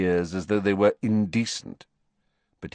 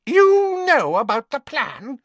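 much higher, louder indignant cry; outraged Bellatrix; 6 dB louder than usual. Acted. Bellatrix outraged that Snape was told, higher and louder. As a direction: spit it out in outrage. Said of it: "look of outrage".

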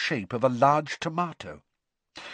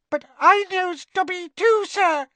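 very high, louder squeaky elf voice; elf squeak; 7 dB louder than usual. Acted. House elf telling Harry she knows Dobby, eager squeak. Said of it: "squeaked the elf".

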